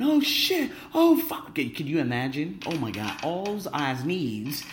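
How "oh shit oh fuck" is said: high mock scream of panic; imagined rafting scream. Imagining himself on the rapids, high panicked mock scream. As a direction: yelp it like you're flipping in the rapids. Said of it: "And me screaming and going".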